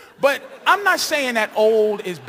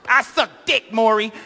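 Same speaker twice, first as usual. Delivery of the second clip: put-on bratty teen; 4 dB louder than usual. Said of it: "She's like".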